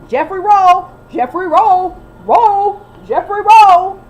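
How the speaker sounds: loud, high repeated hollers; mock yell. Mocking Kelly calling for her husband, loud and high. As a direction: call it out again and again.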